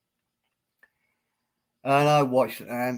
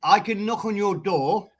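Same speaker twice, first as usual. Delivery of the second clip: ordinary talk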